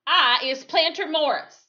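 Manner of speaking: high, loud defiant mock introduction. Man mocking the lawman by giving his name, loud and defiant. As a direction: throw the name back in his face.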